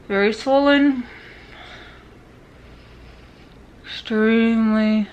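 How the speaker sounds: speaking through wired teeth; talking with mouth closed. Real, not acted. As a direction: talk with jaw wired shut. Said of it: "Just very hard to talk".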